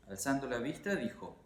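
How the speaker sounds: usual speaking voice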